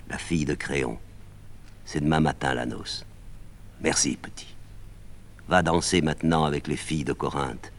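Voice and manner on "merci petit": whispered, almost breathless; whispered thanks. Acted. Médée whispering thanks to the messenger boy.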